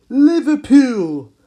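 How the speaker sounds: put-on loud, slow Liverpool accent shout of "Liverpool". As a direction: belt out "Liverpool" like a Liverpool local. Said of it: "That's how they say it in Liverpool".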